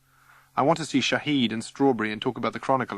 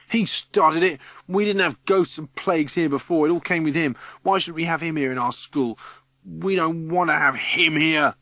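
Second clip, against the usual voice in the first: high, loud playground accusation; rally the mob against him, loudly; angry accusing shout; 6 semitones higher, 4 dB louder than usual. Acted. Ringleader accuses Boris before crowd.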